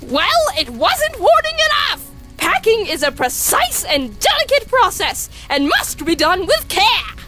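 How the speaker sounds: high, emphatic theatrical complaint; indignant protest. Acted. Brother arguing about packing, indignant. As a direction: declaim each point in a huff.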